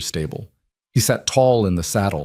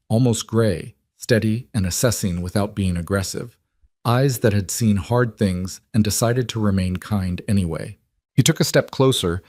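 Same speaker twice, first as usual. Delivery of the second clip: plain reading voice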